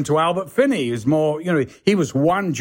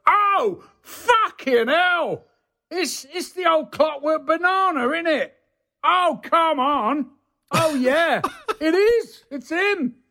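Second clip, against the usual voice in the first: high-pitched, drawn-out star-struck disbelief; imitating astonished driver; 14 semitones higher, slower than usual. Lorry driver spots a film star in the pub, mock astonishment.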